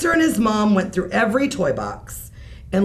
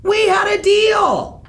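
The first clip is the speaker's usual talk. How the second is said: high, loud, slow furious shout; mother yelling at her son; 13 semitones higher, 5 dB louder, slower than usual. Acted. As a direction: hammer home the broken deal. Mother furious at undone deal.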